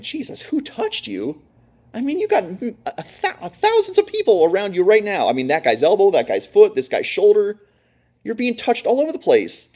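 high, disbelieving disciples pleading with Jesus; incredulous disciples. Retold or imitated. Disciples telling Jesus the crowd touches him, incredulous and high. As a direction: disciples protesting, who could tell.

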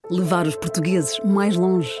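ordinary talk

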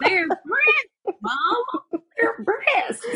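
quoting Carrie through breaking laughter; high, giggly imitation of Carrie; laughing Carrie impression. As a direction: imitate her while cracking up. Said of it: "Why does she say it like that".

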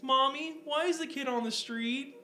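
lightly voiced small child; mock little kid. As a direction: play a naive kid asking. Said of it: "the little kid's like".

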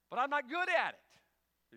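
high, loud, slow mock whine; whiny plea. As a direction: beg like a kid. Pastor whining, retold.

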